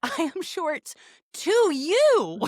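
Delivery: high, rising frustrated insistence; exasperated retort. Retold or imitated. Arguing height with mom, a high exasperated retort. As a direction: push back at her mother. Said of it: "And I was like".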